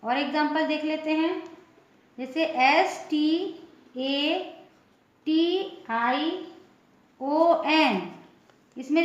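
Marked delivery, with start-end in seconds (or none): none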